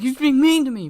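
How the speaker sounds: high, loud tattletale whine; childish high-pitched complaint; whiny complaint. Retold or imitated. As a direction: whine it like a kid telling on someone. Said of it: "He's like".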